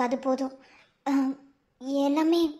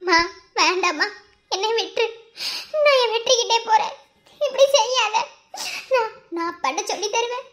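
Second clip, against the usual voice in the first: high, loud pleading broken by sobs; weeping, rising to a loud cry; sobbing plea; 6 semitones higher, 3 dB louder than usual. Acted. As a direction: sob and beg her to let go. Daughter pleads, sobbing, with mother.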